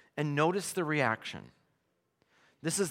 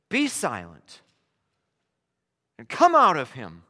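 commanding rebuke; 10 dB louder than usual. Acted. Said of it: "Jesus says".

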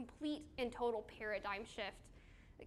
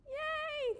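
a much higher, delighted yay; excited cheer; 4 dB louder than usual. Real, not acted. Youth leader cheering a student's right answer, excited. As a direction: cheer like they just won a prize.

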